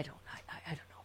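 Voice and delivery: breathy whisper. Real, not acted. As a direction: whisper it helplessly. Host whispers helplessly.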